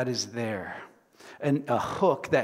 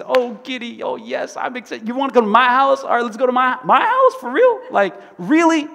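giddy, much higher and louder voice; overjoyed tax collector, high and loud; excited; 9 semitones higher, 10 dB louder than usual. Acted. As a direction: squeal with disbelieving delight. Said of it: "He gets so excited".

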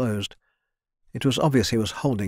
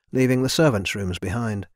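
plain reading voice

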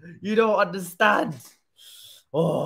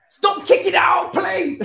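high, loud, slow yell of exasperation; yelling at his goalkeeper in exasperation; frustrated shout; 13 semitones higher, 8 dB louder than usual. Real, not acted. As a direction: bark it at the keeper, fed up.

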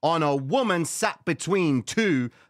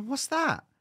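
suspicious surprise; 13 semitones higher than usual. Retold or imitated. Suspicious question.

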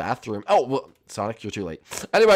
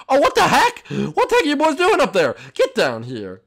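high, loud indignant yell; loud shout; 13 semitones higher, 9 dB louder than usual. Real, not acted. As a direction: yell up at them. Shouting at players.

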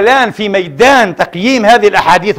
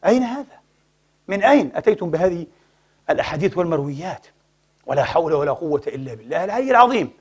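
hushed rebuke; 3 semitones lower, 9 dB quieter than usual. Real, not acted. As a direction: drop to a soft dismayed voice. Preacher drops to rebuke. (عتاب هادئ خافت)

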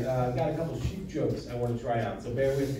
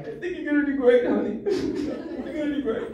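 high, loud sweet-mom voice, half laughing; imitating a mom forcing support; mock mom encouraging her son about stand-up; mom's voice; 12 semitones higher, 4 dB louder than usual. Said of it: "she was like".

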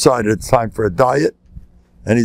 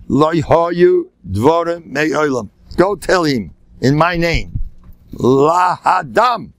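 put-on slow, higher, grand Yiddish-accented pronouncement; Rav Hutner; 5 semitones higher, slower than usual. Rabbi sending word in his name, slow Yiddish-accented declaration. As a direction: draw out each word in a thick Yiddish accent. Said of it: "rub in the accent".

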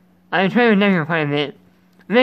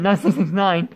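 normal talking voice

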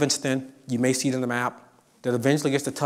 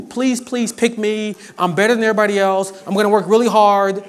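mock pleading applicant; 7 semitones higher, 5 dB louder than usual. Professor mimics students begging to be admitted, mock pleading. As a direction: ham up a pleading applicant, then brag quickly.

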